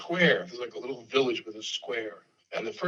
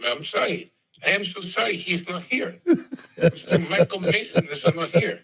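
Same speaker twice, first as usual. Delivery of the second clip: high, put-on Chicago accent apology; 5 semitones higher, 5 dB louder than usual. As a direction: imitate a Chicago receptionist playing dumb. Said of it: "I'm doing my best Chicago accent".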